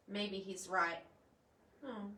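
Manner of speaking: plain reading voice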